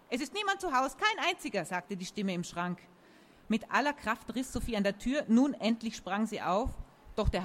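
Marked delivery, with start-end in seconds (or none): 0.1-1.6 high, singsong denial; hiding cupboard dweller. A narrator's reading. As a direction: pretend nobody is home, high-pitched. Hider answers from inside the cupboard.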